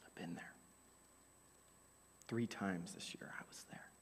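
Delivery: soft, low confessing whisper; a hushed, personal confession; quiet whisper. Real, not acted. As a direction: admit it under your breath. Confession of sinking fear.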